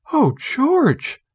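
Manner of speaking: high startled gasp of a name; shocked realization. A narrator's reading. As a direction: catch him out, aghast. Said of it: "she said".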